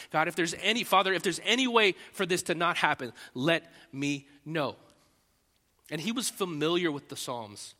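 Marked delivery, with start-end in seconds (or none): 0.1-4.8 urgent plea. Retold or imitated. Jesus pleading in the garden.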